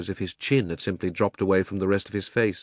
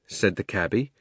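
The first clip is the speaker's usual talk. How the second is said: ordinary delivery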